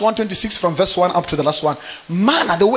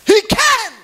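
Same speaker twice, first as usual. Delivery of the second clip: exclaiming; 13 semitones higher, 5 dB louder than usual. Acted. Sermon, sudden burst.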